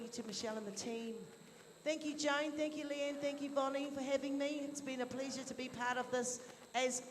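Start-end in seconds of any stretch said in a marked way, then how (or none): none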